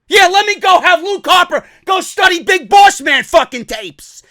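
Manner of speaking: loud, high, scornful sarcasm; mock the absurd demand at a shout; angry sarcasm. Real, not acted. Fan ridiculing a demand for a southern accent, angry sarcasm.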